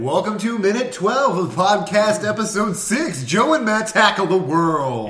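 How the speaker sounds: slow, loud show-host intro; announcer voice. Acted.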